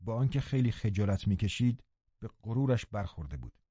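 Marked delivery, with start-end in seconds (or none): none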